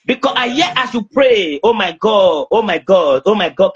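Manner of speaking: usual speaking voice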